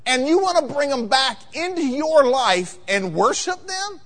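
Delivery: high-pitched, incredulous scolding; preachy sarcasm, pressing each point home; sarcastic prophet. Acted. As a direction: pile up the absurdities at the king. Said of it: "I almost sense a tone of sarcasm".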